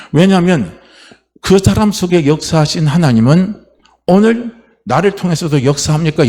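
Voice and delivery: ordinary talk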